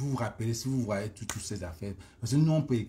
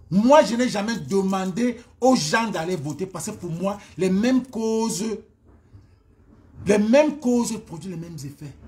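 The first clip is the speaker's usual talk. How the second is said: louder and higher, insistent self-defence; protestation insistante; 5 semitones higher, 7 dB louder than usual. Real, not acted. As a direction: protest forcefully, leaning on each phrase. Se défend avec force. (protestation énergique et insistante)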